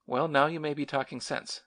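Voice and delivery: ordinary delivery, nothing marked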